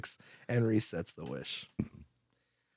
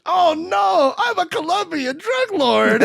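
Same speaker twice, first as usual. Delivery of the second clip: loud, high hammed-up wail; comic shout, stretched out slowly; mock panicked cry; 5 dB louder, slower than usual. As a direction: cry it out in exaggerated dismay. Retold panicked realization in film.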